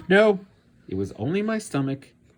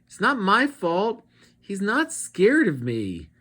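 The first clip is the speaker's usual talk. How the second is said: high, louder whiny protest; whining; 9 semitones higher, 6 dB louder than usual. Acted. Abigail protests to Mister Z, high whine. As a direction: plead like a sulky kid. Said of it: "she whined".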